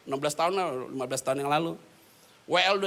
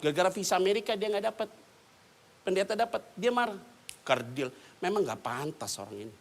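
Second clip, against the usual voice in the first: ordinary talk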